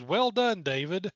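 put-on a light British accent, rising on "Well"; lightly imitating a British announcer. Co-host quoting Squire's congratulation in a light British accent. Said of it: "British and Alabama accents in one scene".

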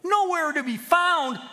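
high, loud, drawn-out disbelief; incredulous. A narrator's reading. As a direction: echo it back in outraged disbelief. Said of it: "Pete was incredulous".

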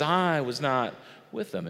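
usual speaking voice

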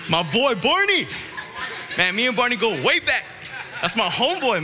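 high, excited hometown bragging; hyped-up. Real, not acted. Preacher showing the youth his boy Barney, excited and hyped. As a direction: hype him up like your best buddy.

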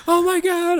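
very high imitation of panicking girls; mock freaking out. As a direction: squeal it in put-on horror. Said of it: "freaking out".

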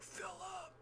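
very high, soft falsetto yell; high-pitched pretend holler, held back in volume; mock mom yell. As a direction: imitate a mom yelling, thin and high. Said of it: "like, yells at me".

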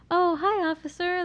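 put-on sweet high-pitched girly voice for a cop; bubbly nice voice. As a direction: play the passive, happy, bubbly female. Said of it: "I can change my voice".

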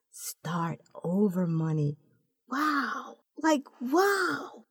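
hushed, slow whisper lifting into high, soft wows; in awe. A narrator's reading. Naomi seeing the balance on the screen, awed. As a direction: breathe it out amazed, wows rising high. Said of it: "In awe".

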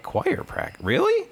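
squeaky high disbelieving question; high pitched mock disbelief; incredulous. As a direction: sound floored he goes to choir. Incredulous reply in retold story.

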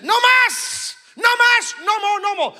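high-pitched mock plea to stop; acted boxer crying out; retold cry of surrender. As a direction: imitate a fighter calling it quits, high and loud. Boxer quitting in the ring, retold.

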